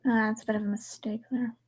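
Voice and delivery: whispered aside; hushed whispered aside. Real, not acted. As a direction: whisper it under your breath. Aside while friend reads.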